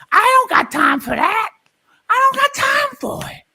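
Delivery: high yelling auntie; shouted quote. Acted. Auntie character yelling she has no time, very high. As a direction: belt it out, fed up. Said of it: "I said".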